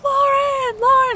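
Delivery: high re-enacted call for help; urgent calling out. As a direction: call her name high, as if across a distance. Retells calling out to friend.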